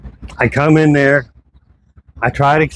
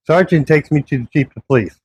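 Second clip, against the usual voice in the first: ordinary talk, nothing marked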